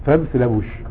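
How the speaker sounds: ordinary talk, nothing marked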